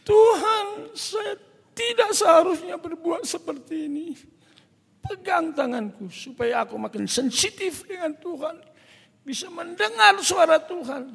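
high-pitched crying plea to God; acted tearful cry; tearful prayer. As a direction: plead upward as if weeping, re-enacted. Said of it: "saya menangis".

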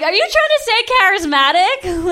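high, loud disbelieving question; high, loud incredulous retort. Retold or imitated. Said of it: "I'm like".

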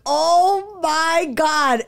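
slow, drawn-out high oh my God; mild mock panic; dread. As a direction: sink as the trooper pulls up. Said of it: "And I'm like".

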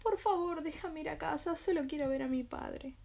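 high, pleading tearful plea; pleading and crying. Acted. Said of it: "suplicando y llorando".

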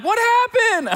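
put-on high, loud wailing kid voice; crying child. Dad imitating his hurt, crying child, high loud whine. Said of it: "They're crying like".

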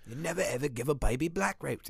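a hint of a stern old woman; quoting the film in a put-on voice; movie character voice. Movie line quoted in character voice.

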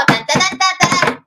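mock jingle. Imitating bus jingle.

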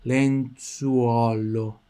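lenzuolo drawn out, lips rounding on U and O; lenzuolo very slowly. Shown as a demonstration. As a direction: mouth every vowel of lenzuolo. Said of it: "Tá vendo?". (lenzuolo bem devagar, mexendo a boca no U e no O)

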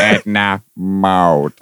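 slow, drawn-out diva voice; Edna Mode. Shown as a demonstration. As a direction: stretch each syllable like a fashion diva. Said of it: "can you do your best impression of Edna Mode".

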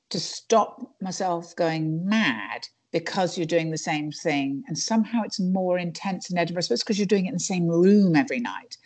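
usual speaking voice

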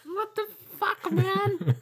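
shrill outraged little monster; squeaky bullywug. Retold or imitated. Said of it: "They're like".

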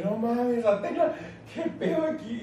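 high, mock-shocked squeal; a high, teasing cry of disbelief; mock surprise. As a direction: act scandalized and amused. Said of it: "Yo dije".

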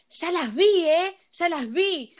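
put-on scolding shout, retold; imitating a shouting lady. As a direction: mimic an indignant woman calling out a threat. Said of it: "unos gritos de una señora como".

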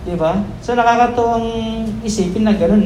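usual speaking voice

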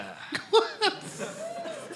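high rising laugh. Real, not acted. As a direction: burst out laughing. Laughs at the answer.

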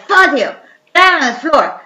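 bossy order. Acted. Casino owner ordering Fazio.

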